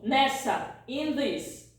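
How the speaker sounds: normal talking voice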